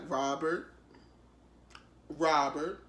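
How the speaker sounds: gently imitated calm interviewer; calm soothing. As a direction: soothe him like a nurse with a patient. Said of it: "she was on her best Shepherd Pratt".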